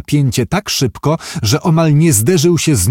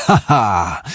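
acted gloating ha-ha; short, self-satisfied stage laugh; 3 semitones lower than usual. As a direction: laugh smugly at the rivals' misfortune. Gloating laugh in character's monologue.

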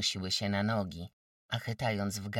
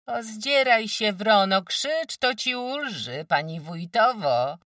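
mocking shout; 12 semitones higher, 11 dB louder than usual. Village women's quarrel.

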